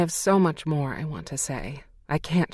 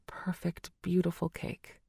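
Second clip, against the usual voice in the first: softer, almost whispered praise; tender hush; 3 semitones higher, 7 dB quieter than usual. Acted. Mom telling her daughter she is perfect, tender hush. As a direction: let it drop to a loving hush.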